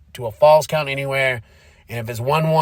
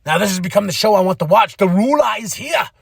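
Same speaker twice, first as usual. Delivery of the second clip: excited; 6 semitones higher, 4 dB louder than usual. Retold or imitated. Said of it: "I'm gonna be like".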